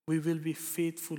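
ordinary delivery

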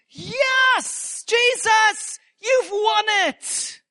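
high, jubilant shout of praise; exultant shout. Acted. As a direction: cry it out in triumph, stretching the words. Acting out a joyful response.

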